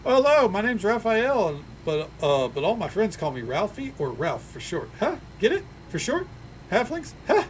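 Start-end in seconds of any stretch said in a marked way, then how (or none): 0.0-1.6 slow, high, beaming hello; cheery halfling tourist. Acted. Halfling greets the party, slow and beaming. 5.0-7.4 high, eager joke-seeking voice; pleased with a pun. Acted. Halfling nudges the party about his pun, high and eager.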